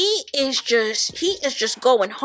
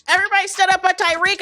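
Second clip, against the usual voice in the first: higher, fast repeat in disbelief; amused disbelief; 6 semitones higher, much faster than usual. Real, not acted. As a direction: say it again, fast and incredulous. Repeats after laughing.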